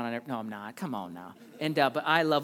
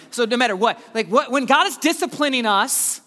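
loud and high; full-force preaching; 7 semitones higher, 8 dB louder than usual. Acted. Pastor challenging the church, preaching hard. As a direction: press the question on them.

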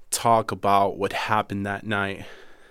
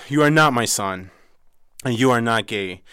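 harsh rejection; 2 semitones higher, 6 dB louder than usual. Retold or imitated.

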